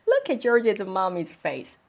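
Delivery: ordinary delivery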